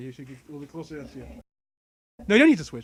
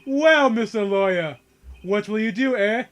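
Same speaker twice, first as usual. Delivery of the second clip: pompous, booming tycoon sneer; haughty villain voice; 3 semitones higher, 9 dB louder than usual. Acted. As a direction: lord it over a lowly lawyer.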